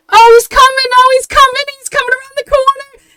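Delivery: very high, loud frantic fangirl shriek; excited fan squeal. Acted. Townsperson spots the idol coming, high excited squeal. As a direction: squeal it like a star is arriving.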